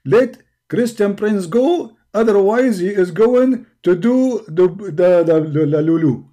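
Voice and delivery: hammed-up voice of God; mock solemn decree; mock divine voice. Allah voiced reciting threat.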